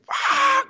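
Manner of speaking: short high-pitched scream, imitated; mock scream. Loser screaming into an office pillow, mock scream. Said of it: "grab a pillow and scream".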